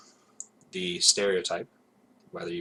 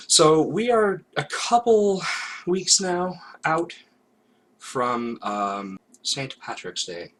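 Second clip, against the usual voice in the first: put-on Irish accent; 7 semitones higher, 5 dB louder, much slower than usual. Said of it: "a little bit of an Irish accent".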